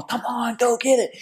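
high, urgent cheer from the sidelines; cheering spectator. Retold or imitated. Spectators to a passing racer, cheering.

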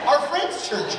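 exasperated repeat. Retold or imitated. She answers nosy askers a second time, exasperated. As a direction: say it again, fed up with the question. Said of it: "Yeah, but what church are you going to?".

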